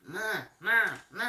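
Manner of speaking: high loud squawky bird call. Retold or imitated. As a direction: call out like a parrot. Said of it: "calling out".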